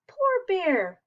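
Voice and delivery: a light mouse squeak of sympathy. Acted. Mouse pities stuck Bear, mild squeak. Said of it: "Mouse squeaks".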